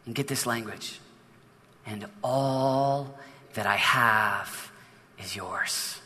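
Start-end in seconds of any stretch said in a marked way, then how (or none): 1.8-6.0 very slow and soft, the father's loving words; tender hush. Acted. Pastor voicing the father's promise to his son, slow and soft. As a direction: let each word fall gently and softly. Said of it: "Let's do that again".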